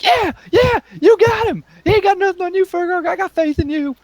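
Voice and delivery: very high, squealing donkey voice; excited cartoon sidekick cheering from the back; squeaky, eager encouragement; cheerleading donkey. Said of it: "Donkey just stands back and he's like".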